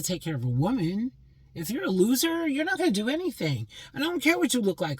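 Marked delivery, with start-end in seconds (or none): 1.6-5.0 higher, heated scolding. Real, not acted. Woman scolds loser men, pitched high and heated. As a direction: talk down to them heatedly.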